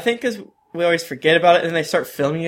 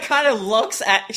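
ordinary delivery

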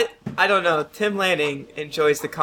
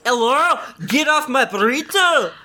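higher, mock-indignant yell; mock shout; 6 semitones higher than usual. Character to an intruder, hammed-up indignant yell. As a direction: shoo someone off your burrito.

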